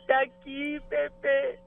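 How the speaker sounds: put-on high, slow giggly toddler voice; baby voice. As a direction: drawl it out like a baby. Baby-talk game with host.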